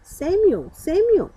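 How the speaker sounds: high, ringing call from afar; a voice calling a sleeping boy by name. A narrator's reading. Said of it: "a voice calling out to him".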